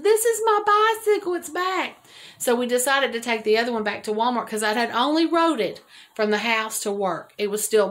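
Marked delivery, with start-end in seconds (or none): 0.0-2.1 high, loud cry of delight; thrilled. Retold or imitated. Telling her husband the stolen bike came back, thrilled. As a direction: squeal it out, overjoyed. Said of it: "I said".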